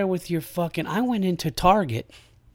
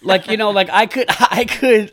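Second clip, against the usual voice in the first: laughing; 6 semitones higher, 6 dB louder than usual. Real, not acted. Laughing at the unacceptable story.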